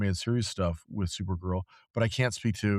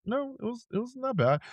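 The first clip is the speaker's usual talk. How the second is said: high, soft mock protest; 13 semitones higher, 3 dB quieter than usual. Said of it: "me and the owner like".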